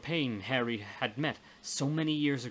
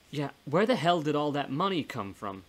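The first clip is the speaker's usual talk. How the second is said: louder, higher, incredulous outburst; incredulous demand; 4 semitones higher, 5 dB louder than usual. Acted. Man questions ghost friend about the money, incredulous demand. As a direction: burst out with disbelief. Challenges her about the offices.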